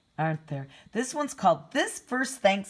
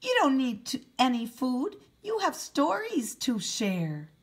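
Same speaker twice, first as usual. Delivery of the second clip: high little mouse voice, louder at the start; squeaky mouse; 7 semitones higher, 4 dB louder than usual. Acted. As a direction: comfort the bear as a tiny squeaking mouse. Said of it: "Mouse squeaks".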